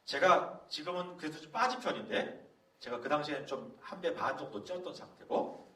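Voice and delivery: ordinary talk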